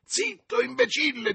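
very high sharp rebuke; irritated master snapping at servant; angry hush. A narrator's reading. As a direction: cut the fool off. Master silences panicking servant.

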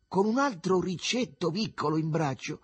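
ordinary delivery

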